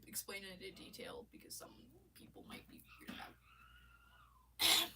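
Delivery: hushed secret-sharing whisper. Real, not acted. Whispering to viewers about something weird. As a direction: lean in and whisper it.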